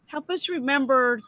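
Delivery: ordinary delivery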